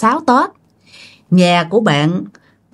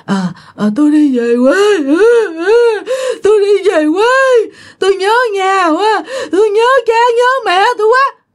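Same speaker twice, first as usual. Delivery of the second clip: high, crying wail, homesick; a possessed mother sobbing she wants to go home; 10 semitones higher than usual. Retold or imitated. Possessed mother wailing to her husband, crying high.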